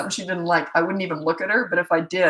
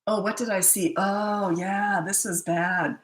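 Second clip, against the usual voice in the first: normal talking voice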